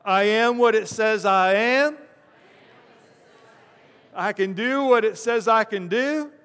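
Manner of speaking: hammed-up Texan drawl, line by line; mock preacher's creed in a Texan twang. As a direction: lead a call-and-repeat in a Texan drawl. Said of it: "with your best, best Texan drawl".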